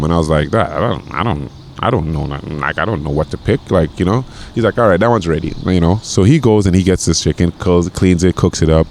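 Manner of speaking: ordinary talk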